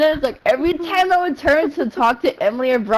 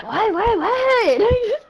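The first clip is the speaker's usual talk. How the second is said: rising, higher with each repeat; retold exasperated reply; 7 semitones higher, much slower than usual. Answering Axel's repeated calling, exasperated. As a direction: fire back a climbing mock-exasperated triple.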